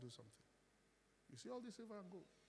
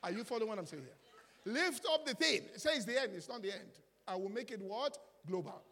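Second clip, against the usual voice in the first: much louder and higher, rousing; preaching at full force; 6 semitones higher, 12 dB louder than usual. Acted. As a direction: fire up the congregation.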